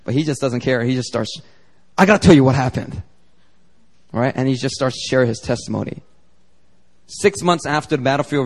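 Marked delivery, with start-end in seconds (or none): 2.0-3.0 louder, eager burst of news; retold eagerness. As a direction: imitate a friend bursting to tell. Retold friend bursting to testify.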